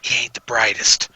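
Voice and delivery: hushed, but not quite whispering; softened confidential aside; half whisper. Acted. Player confides to the sergeant about his drunk friend, half whispering. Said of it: "whisper, but not whisper".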